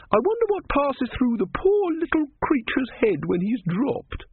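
a kindly woman fretting over the tortoise; pitying woman. A narrator's reading.

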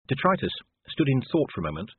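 ordinary delivery

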